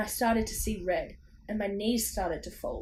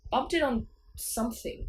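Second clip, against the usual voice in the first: usual speaking voice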